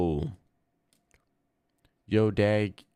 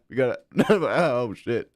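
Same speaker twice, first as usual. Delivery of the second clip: ordinary delivery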